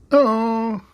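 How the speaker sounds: high, loud squeaky Teletubby "Oh". Acted. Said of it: "if you know the Teletubbies".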